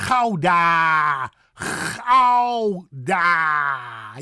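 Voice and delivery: loud, stretched Gouda with scraped g; huge throaty Dutch Gouda; exaggerated pronunciation. Shown as a demonstration. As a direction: overdo Gouda, "khow-daaa". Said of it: "I'm exaggerating".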